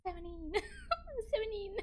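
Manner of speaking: high squeaky repeat through laughter; giggling mock attempt. Streamer failing an American accent, giggling high. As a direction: squeak the word while cracking up.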